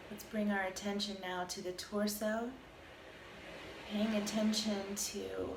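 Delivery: calm, even meditation voice with long pauses; guided meditation. Acted. Teacher guiding listeners through a body scan, calm meditation voice. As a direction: lead a body scan, unhurried, leaving space between phrases.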